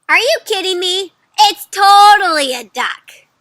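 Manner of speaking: loud, high-pitched disbelief; hammed-up storybook indignation; incredulous arguing. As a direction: protest hard, stretching the key word. Duck believer argues back.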